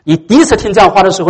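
usual speaking voice

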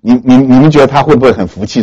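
ordinary talk, nothing marked

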